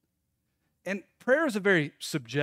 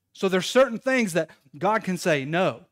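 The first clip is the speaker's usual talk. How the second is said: normal talking voice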